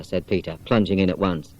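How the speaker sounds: usual speaking voice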